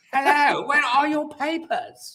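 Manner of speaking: high, slow mock German with a posh hello; high, slow hammed-up German guard. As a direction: blend posh English and German officer. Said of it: "Poor German accents".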